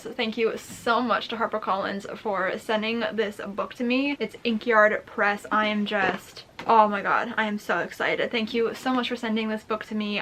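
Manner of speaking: ordinary delivery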